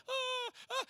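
high, hammed-up crying gasps; mock sobbing. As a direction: imitate a viewer choking up. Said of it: "and you're crying".

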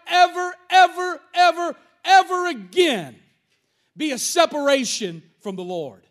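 building, forceful pulpit delivery; preacher swelling with conviction; emphatic preaching. Real, not acted. Preaches on the verse with rising force.

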